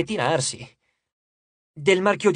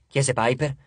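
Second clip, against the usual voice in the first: usual speaking voice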